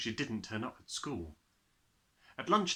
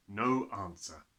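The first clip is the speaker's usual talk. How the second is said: usual speaking voice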